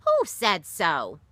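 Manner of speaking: slow, loud indignant demand; bristling. Acted. Grandmother bristling at Nat's gossip, slow and loud. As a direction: bristle at the boy, slow and sharp. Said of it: "Granny bristled".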